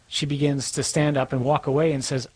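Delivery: ordinary talk, nothing marked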